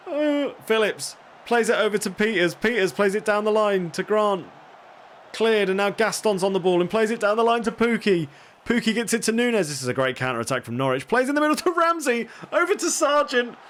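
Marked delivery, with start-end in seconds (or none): 0.1-9.7 higher, rising play-by-play as the attack builds; excited commentary. Real, not acted. Streamer commentates the opposing team's counter-attack, getting excited. 11.1-13.6 much higher, urgent play-by-play; peak excited commentary. Real, not acted. Streamer watches the winning chance unfold, excited and urgent. Counter-attack reaches the box.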